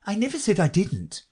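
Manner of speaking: put-on high-pitched interrupting girl; Alice. A narrator's reading. Alice interrupts the Mock Turtle, high-pitched protest. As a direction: cut in with a light high voice. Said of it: "interrupted Alice".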